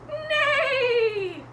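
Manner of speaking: high, loud, falling whinny; horse neigh. Acted. Horse comes along to the boat, whinnying.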